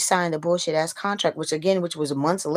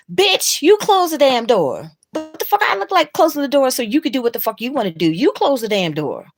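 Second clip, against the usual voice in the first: imagined yell at the man at her door; furious shout; 9 semitones higher, 8 dB louder than usual. Retold or imitated. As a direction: blast him with it. What she should have said.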